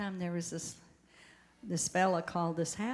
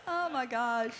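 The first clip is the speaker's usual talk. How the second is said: ordinary talk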